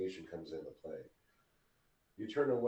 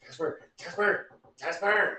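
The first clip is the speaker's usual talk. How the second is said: urgent call through door; 8 semitones higher, 6 dB louder than usual. Acted. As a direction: call his name, rising. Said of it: "Like that?".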